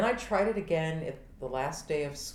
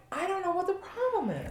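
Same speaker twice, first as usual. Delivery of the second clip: very high-pitched, helpless protest; hammed-up squeal of confusion; mock bafflement; 14 semitones higher than usual. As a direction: whine it way up high as if clueless. Acting out her helplessness about swearing.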